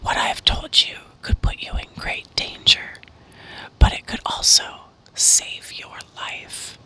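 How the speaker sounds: ordinary delivery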